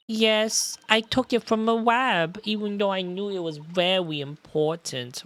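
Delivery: put-on childish voice with r's as w's; small-child voice, owning up; guilty little girl. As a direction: confess like a sorry small child. Voices the child Iris in game.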